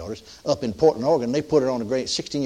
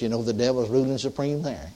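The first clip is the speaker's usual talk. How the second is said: normal talking voice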